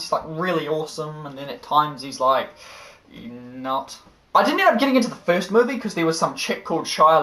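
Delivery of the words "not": flat whisper; whispered deadpan. Acted. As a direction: breathe it out flat.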